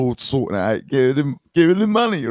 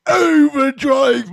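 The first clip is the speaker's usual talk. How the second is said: emphatic; 11 semitones higher, 3 dB louder, slower than usual. Real, not acted. Gossip mill in overdrive.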